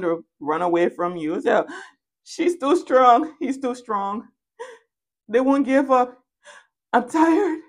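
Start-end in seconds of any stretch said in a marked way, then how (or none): 2.2-7.6 high, mock-weary complaint; hammed-up, high-pitched tiredness; exhausted whining. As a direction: whine like you're giving up the chase. Voices the worn-out pursuers whining.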